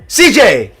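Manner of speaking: root loudly for the winner; excited cheering. Real, not acted. Cheering ducks in a race.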